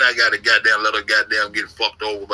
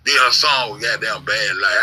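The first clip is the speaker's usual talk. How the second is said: ordinary delivery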